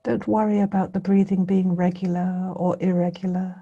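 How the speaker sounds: meditation guidance in spaced phrases, long pauses; guided meditation. Real, not acted. Teacher bringing listeners' attention gently to the breathing, guided meditation. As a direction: leave silence between phrases as you guide.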